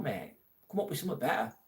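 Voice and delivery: ordinary delivery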